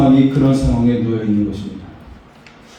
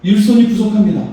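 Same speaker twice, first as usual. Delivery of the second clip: a touch of worried people pleading, higher; complaining townspeople; 8 semitones higher than usual. Retold or imitated. Preacher voicing Judah's people complaining to him, higher and anxious. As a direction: voice the anxious builders crying out, raised pitch. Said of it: "그렇게 말을 하고 있는 것입니다".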